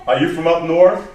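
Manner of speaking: loud retold question from the interviewer; imitated interviewer. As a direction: play the interviewer taken aback by an accent. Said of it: "He went like this".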